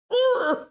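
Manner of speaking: a short, loud, very high laugh. Real, not acted. Host laughing at being teased in chat, loud high laugh. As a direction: yelp out one sharp laugh.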